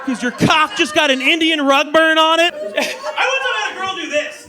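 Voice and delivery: normal talking voice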